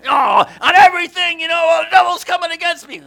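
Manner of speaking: high hammed-up frantic voice; mock alarmed about the devil; mock panic. Mocking frantic spiritual warfare.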